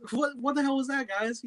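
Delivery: high, baffled what the fuck; exasperated outburst. Retold or imitated. Quill berating the team after the fight, baffled. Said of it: "It's like".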